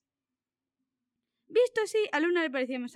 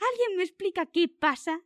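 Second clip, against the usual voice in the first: louder, higher exasperated shout; a mild acted yell of confusion; 6 semitones higher, 5 dB louder than usual. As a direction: demand an answer, raised voice. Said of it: "les gritó".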